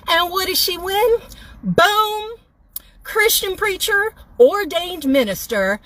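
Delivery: high, hammed-up announcer voice, drawn out; mock-congratulate the other woman like a prize host; bitter mock prize announcement; sarcastic game-show reveal. Real, not acted.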